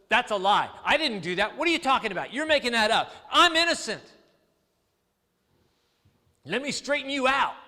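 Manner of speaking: loud protest, rising in pitch; accused man loudly pleading innocence; indignant denial. Acted. Voicing accused men defending themselves.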